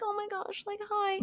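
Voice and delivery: high, soft imitated coo; retold tender greeting. Mother seeing Simone at her feet, retold soft greeting. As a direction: greet a child in a high, hushed voice.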